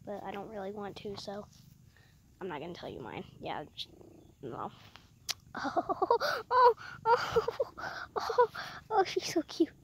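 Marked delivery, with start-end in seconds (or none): 5.5-9.7 high, loud cooing; gushing. Real, not acted. Kid cooing over the cat, high and excited. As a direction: gush over how cute she is.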